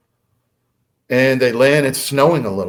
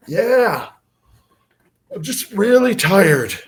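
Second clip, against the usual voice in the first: sleepy; 9 semitones higher, 4 dB louder, slower than usual. Retold or imitated. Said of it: "Sean was falling asleep".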